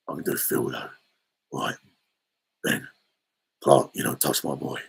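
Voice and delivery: very low grunted soap hard-man voice; Phil Mitchell impression. Streamer impersonating a soap hard man warning someone off, low grunt. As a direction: drop right down and grunt it out. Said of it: "Phil's just a grunt".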